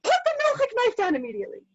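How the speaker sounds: fast, high-pitched scream; acted mother yelling at her boys; screamed command. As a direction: scream the order, pitch falling at the end. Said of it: "literally heard me scream that".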